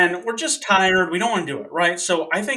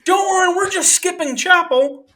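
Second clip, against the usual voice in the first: much higher mock naive voice; imitating friend; 12 semitones higher, 4 dB louder than usual. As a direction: blurt it innocently. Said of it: "J C goes".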